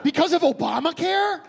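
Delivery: high, loud laughing disbelief; incredulous. Retold or imitated. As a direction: echo it back stunned. Incredulous echo of punchline.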